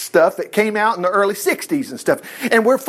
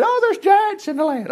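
much higher, mock-frightened cry of the doubters; fearful Israelites; 13 semitones higher than usual. Israelites afraid of the giants, mock-frightened high whine. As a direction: whine it like scared people spotting giants. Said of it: "But they said".

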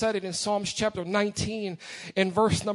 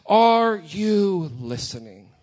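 emphatic call; 3 semitones lower, 6 dB louder, slower than usual. Real, not acted. Preacher presses the congregation.

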